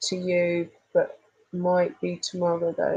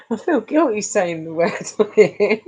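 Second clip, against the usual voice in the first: usual speaking voice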